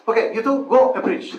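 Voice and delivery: loud, high mock command; brisk leader's order. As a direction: dispatch two novices briskly, laughter close behind. Re-enacts the leader sending them off.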